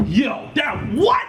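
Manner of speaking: high, drawn-out mock shout of amazement; mimicked hyped fan. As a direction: react like a fan floored by a line. Acts out thrilled fans' reaction.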